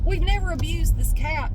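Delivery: ordinary talk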